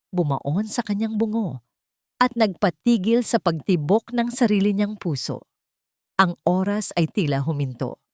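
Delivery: ordinary delivery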